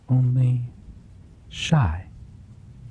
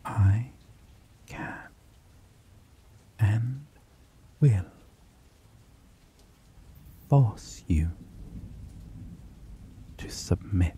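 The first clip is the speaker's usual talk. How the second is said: menacing whisper; 5 dB quieter than usual. Acted. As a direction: drop to a threatening whisper, word by word. Threatening the captive.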